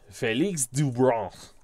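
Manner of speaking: slow, clumsy French-accented name; bad French accent. Shown as a demonstration. Announcer attempting the new pitcher's French name, bad French accent. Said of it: "terrible attempt at a French accent".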